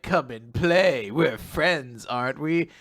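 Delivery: slow, sinister taunt; evil villain. Retold or imitated. Host voicing evil Shura taunting a chained robot, slow sinister tease. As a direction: drawl it like a cruel captor teasing. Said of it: "Shura being evil, and he's like".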